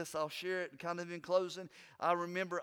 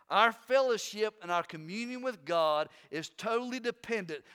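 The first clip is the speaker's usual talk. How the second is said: loud, raised preacher's voice building the point; preaching at full force; 4 semitones higher, 6 dB louder than usual. Acted. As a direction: drive it home to the congregation.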